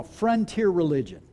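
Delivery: normal talking voice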